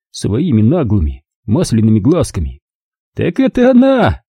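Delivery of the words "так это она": curious. A narrator's reading. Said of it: "голосом, в котором сквозило любопытство".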